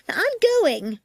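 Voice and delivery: high, loud fearful insistence; frightened woman. Acted. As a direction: insist shrilly on leaving. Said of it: "said a woman".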